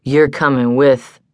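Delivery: slightly slurred, unfinished sentence; lazy slurring man. A narrator's reading. Man telling the woman she's coming with him, lazily slurred. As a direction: let the words slur lazily, as if from drink. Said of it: "slurring his words from laziness, possibly, or drink".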